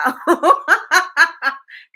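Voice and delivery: loud, high burst of real laughter; big high-pitched laugh. Real, not acted. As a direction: crack up loudly and let it run. Cracks up after her catchphrase.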